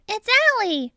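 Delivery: high, excited crowd call; excited storybook crowd; crowd answering. A narrator's reading. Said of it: "The crowd answered".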